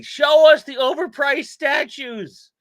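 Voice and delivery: high, loud, drawn-out shout; slow hammed-up yell; mock demanding viewer. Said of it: "Bill already with the".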